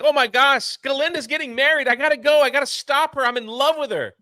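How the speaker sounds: high, breakneck hammed-up urgency; mock lovestruck panic. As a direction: imitate a frantic lover who must rush off. Acting out lovestruck boy's panic.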